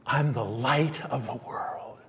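slow hushed whisper of Jesus' words. Acted. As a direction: breathe the words, barely voiced. Quoting Jesus in sermon.

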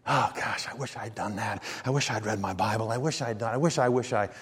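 low, soft, regretful self-reproach; guilty voice. Acted. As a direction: act out guilt-ridden muttered wishes, low and quiet. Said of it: "Jesus doesn't have a guilty voice".

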